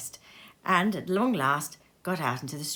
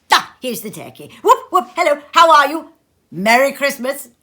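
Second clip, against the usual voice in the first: much louder, higher joyful calling; calling out gleefully; 6 semitones higher, 12 dB louder than usual. A narrator's reading. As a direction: hail the boy with delight. Scrooge calling to the boy.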